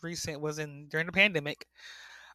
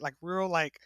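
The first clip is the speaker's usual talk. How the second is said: ordinary talk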